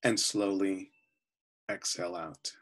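calm, unhurried voice with long pauses between phrases; guided meditation. Real, not acted. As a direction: guide them gently into relaxation, often softening at phrase ends.

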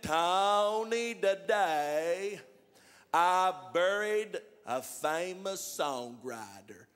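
very slow, drawn-out caller; slow-talking John on the phone; John's slow talk. Retold or imitated. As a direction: stretch every word like a slow talker. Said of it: "John talks like that".

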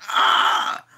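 high unvoiced startled gasp; mock gasp. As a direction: gasp in disgust. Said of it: "We like".

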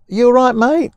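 high, chirpy "you all right, mate"; sarcastic imitation of a friendly call; mock caring Trump. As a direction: pretend concern in a bright high voice. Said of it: "did Trump phone up Pence and say".

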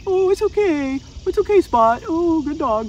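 put-on high, sing-song baby talk to a puppy; mock cooing owner. Trainer mocks owners cooing over their tantrum puppy, in high baby talk. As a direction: coo at the dog like a doting owner.

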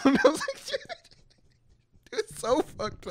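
high-pitched laughing, slowing down; laughing disbelief. Real, not acted. Friend laughing at teacher's bitter advice.

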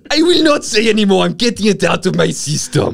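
high, loud mock Zimbabwean accent; exaggerated Zimbabwean accent, pitched right up. Co-host getting the voice out of his system, loud mock Zimbabwean accent. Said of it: "how to do the voice".

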